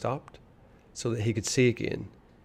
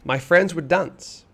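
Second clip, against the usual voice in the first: slow, higher and louder coaxing; coaxing boy; 6 semitones higher, 6 dB louder than usual. A narrator's reading. Boy luring Ivan to the dance, slow and coaxing. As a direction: tempt him with the dance. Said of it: "he said once more".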